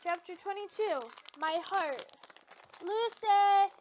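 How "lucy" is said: loud high call across a distance. Acted. Natsu calling out to Lucy from afar. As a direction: shout her name to get attention. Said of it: "Natsu called out".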